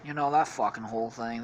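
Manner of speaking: ordinary talk, nothing marked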